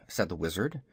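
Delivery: ordinary delivery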